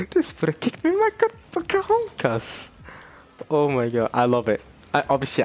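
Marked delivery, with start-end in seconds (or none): 0.1-2.7 very high, squeaky mock Colombian accent; badly faked Colombian accent, squealing in pain; rough Colombian accent. Quoting the man kicked in the groin, falsetto mock Colombian accent. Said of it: "I'm not doing the accent properly".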